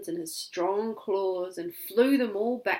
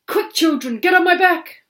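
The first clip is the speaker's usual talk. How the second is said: loud, higher urgent command; urgent eagle call; 10 semitones higher, 12 dB louder than usual. Acted. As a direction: hurry them aboard. Eagle urges children.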